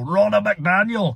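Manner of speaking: slow Scottish brogue, rolling Ronna McDaniel. Shown as a demonstration. Host rolling her name in a Scottish brogue, slow. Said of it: "I wanna go into a Scottish brogue".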